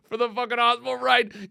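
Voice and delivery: ordinary delivery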